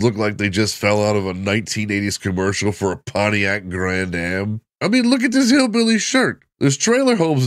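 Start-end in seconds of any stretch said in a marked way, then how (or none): 4.8-7.4 excited. Real, not acted. Mocking hillbilly look.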